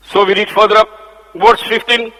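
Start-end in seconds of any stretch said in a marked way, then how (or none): none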